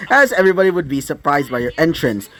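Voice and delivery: ordinary delivery, nothing marked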